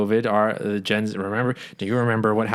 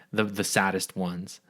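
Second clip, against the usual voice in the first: normal talking voice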